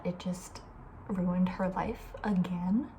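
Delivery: usual speaking voice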